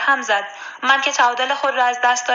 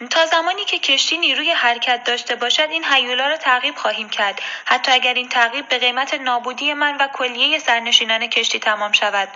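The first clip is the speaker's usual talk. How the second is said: ordinary delivery, nothing marked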